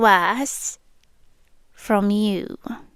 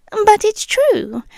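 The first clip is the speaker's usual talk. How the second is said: normal talking voice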